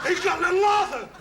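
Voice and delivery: high, loud outraged cry; shocked dismay. Acted. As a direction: gasp it out in horror. Dismay at vicar's fish.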